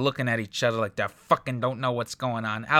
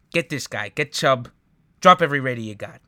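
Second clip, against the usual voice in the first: louder, fast and pushy, ordering the viewers; shouted urging; 8 dB louder than usual. Real, not acted. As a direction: bark it out like a rallying order. Urging fantasy players to pick him.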